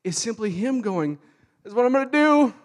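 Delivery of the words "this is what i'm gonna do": high, loud, ridiculous nagging; loud nagging devil. Acted. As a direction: pester loudly like a mock villain. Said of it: "this loud, ridiculous, nagging voice".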